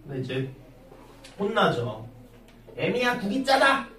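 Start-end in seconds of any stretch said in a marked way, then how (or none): 2.8-3.9 scolding mother-in-law. Retold or imitated. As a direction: scold her daughter-in-law over the soup. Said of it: "막 이러잖아".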